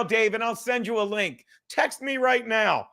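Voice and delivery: normal talking voice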